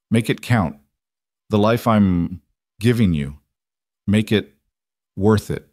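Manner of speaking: last words in separated pieces; dying, halting. A narrator's reading. Lucas dying, charging James to live well, halting. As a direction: spend effort on each short phrase.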